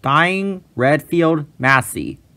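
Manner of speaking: ordinary delivery, nothing marked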